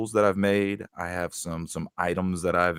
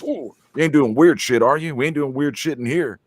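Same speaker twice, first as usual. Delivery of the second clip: higher, louder startled guy; coworker's startled outburst; 7 semitones higher, 5 dB louder than usual. Retold or imitated. Big coworker jumping as someone walks in, startled outburst. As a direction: jump and blurt it out, caught off guard. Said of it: "he just jumps like this and goes".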